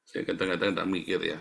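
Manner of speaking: ordinary talk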